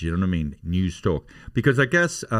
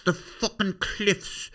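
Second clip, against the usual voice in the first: mock tourism ad; 11 semitones higher than usual. Mock dramatic tourist ad read.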